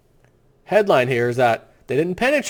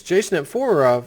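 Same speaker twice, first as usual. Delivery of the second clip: ordinary talk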